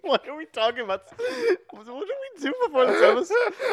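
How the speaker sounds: high, breaking up with laughter; laughing hard. Real, not acted. Podcaster losing it mid-episode, real helpless laughter. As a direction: squeeze the words out through helpless giggles. Helpless giggling derails the talk.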